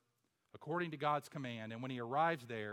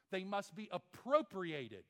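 ordinary talk, nothing marked

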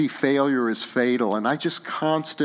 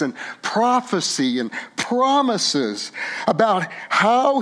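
higher, building sermon climax; impassioned preaching; 7 semitones higher than usual. Real, not acted. Pastor pressing the congregation toward hope, impassioned. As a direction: build the pulpit appeal to a ringing yes. Preaching rising to full force.

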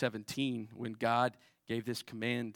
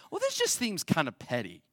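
mock doubting voice, higher and louder; skeptical objector; 3 semitones higher, 4 dB louder than usual. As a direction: voice the doubter who finds it petty. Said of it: "heard someone else say this and say".

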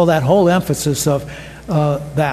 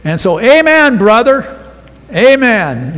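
louder, rising into a fervent amen brother; enthusiastic amen; 4 semitones higher, 5 dB louder than usual. Retold or imitated. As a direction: cheer it like a congregant in the pews. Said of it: "what they were saying is".

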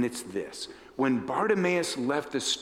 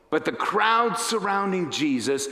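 ordinary talk

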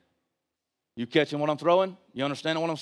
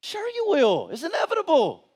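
high, ringing preacher's cry; impassioned, high-pitched sermon delivery; full-force preaching; 11 semitones higher than usual. Real, not acted. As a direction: hammer out rhetorical questions from the pulpit.

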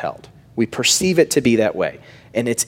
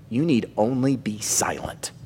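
hushed whisper; 6 dB quieter than usual. Retold or imitated. Moses hushing the fearful Israelites in a whisper. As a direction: whisper You need only be silent. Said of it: "And Moses says to them".

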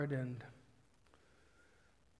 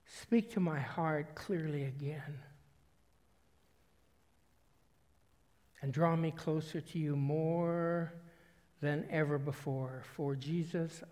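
measured prayer with long pauses between phrases; slow prayer reading. Real, not acted. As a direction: read it slowly to the Lord, leaving space to reflect. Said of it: "I'm gonna read it slower, slowly".

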